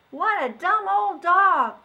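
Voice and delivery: put-on high, loud, slow child voice; jeering children. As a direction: sneer at the dog. Children mock tired dog.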